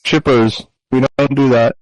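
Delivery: usual speaking voice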